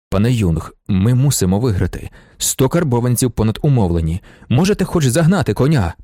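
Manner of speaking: usual speaking voice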